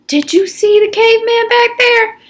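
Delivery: put-on high, eager teen voice; X-Ray's voice. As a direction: voice X-Ray up high and keen. X-Ray asks about the caveman.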